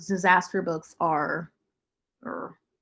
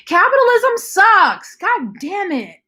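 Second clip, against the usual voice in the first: higher, louder, slow exasperated cry; exasperated outburst; 11 semitones higher, 5 dB louder, slower than usual. Real, not acted. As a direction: vent the frustration loudly, drawn out. Outburst at publisher news.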